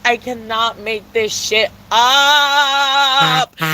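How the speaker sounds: drawn-out, deliberate emphasis; slow emphatic. Real, not acted. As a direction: lay each word down slowly.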